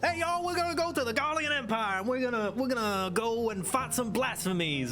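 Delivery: normal talking voice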